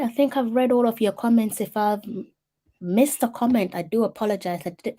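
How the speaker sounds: ordinary talk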